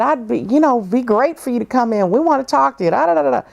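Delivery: high, upbeat, eager welcome; eager sales pitch. Retold or imitated. Agent on the phone pitching to her, high and eager. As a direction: oversell the invitation. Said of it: "And the guy said".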